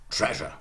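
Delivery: TREASURE as "TREZH-uh", front stress; modern English. Shown as a demonstration. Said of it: "modern English".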